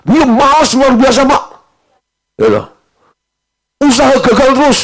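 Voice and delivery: high, loud nagging wife complaining; wife griping to the pastor, high and loud. Retold or imitated. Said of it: "istrinya langsung nyecer gini".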